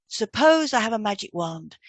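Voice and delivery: ordinary talk, nothing marked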